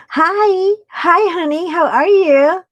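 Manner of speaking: high, bright hello to viewers; excited greeting. Real, not acted. Streamer greeting viewers joining live, excited and high. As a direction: welcome them warmly and high.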